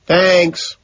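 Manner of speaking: high called thanks; calling out. A narrator's reading. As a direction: call it back across the street. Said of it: "I call out".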